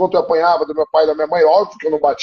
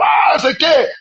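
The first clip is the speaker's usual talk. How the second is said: sharp high mock yell of an angry father; father's yell; 7 semitones higher than usual. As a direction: bark it out like the angry dad at homework. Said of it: "e ele gritava".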